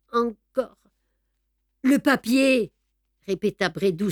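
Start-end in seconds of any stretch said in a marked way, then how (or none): 1.8-2.7 un brin plus haut et plus fort, menace pressante; greffier menaçant. Acted. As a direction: presser sa victime sous le revolver. Répète sa menace. (Brédoux menace Beautrelet, voix dure et pressante)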